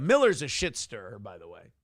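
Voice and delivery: normal talking voice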